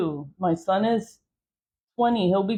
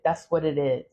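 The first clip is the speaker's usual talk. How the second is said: normal talking voice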